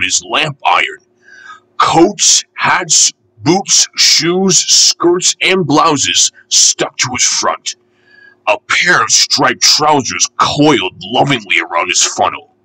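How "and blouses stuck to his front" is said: ordinary delivery, nothing marked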